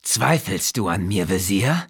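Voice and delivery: lightly voiced king, smirking menace in it; cruel smirk. Priest-king mocks his doubting vizier, lightly voiced cruel smirk. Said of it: "mit einem grausamen Schmunzeln".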